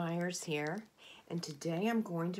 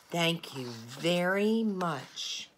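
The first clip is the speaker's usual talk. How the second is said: very slow, louder thanks; slow grateful thanks; 5 dB louder, much slower than usual. Acted. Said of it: "And he says".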